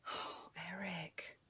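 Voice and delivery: breathy whisper under her breath; whispered aside. Real, not acted. Exasperated owner whispering to her pest of a cat. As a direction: whisper the cat's name in exasperation.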